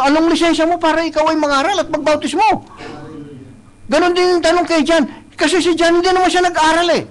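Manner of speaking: impassioned pulpit delivery; preaching. Acted. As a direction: preach it out to the congregation. Preacher building to full force.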